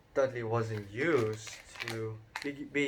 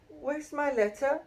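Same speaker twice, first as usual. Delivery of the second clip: put-on boy Harry. A narrator's reading. Harry confronting uncle.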